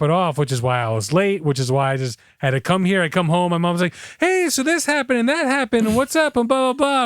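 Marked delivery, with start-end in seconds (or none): 4.2-7.0 high, quick chatter imitating his mom; high mom voice, a little mocking; mock chirpy mom. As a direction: rattle it off like mom catching you at the door. Mom greeting him at home.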